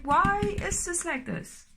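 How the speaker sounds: a mild mock wail at the universe; exasperated quote. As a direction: stretch it out in helpless frustration. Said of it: "and you're like".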